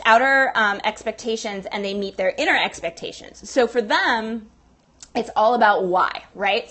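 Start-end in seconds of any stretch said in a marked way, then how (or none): none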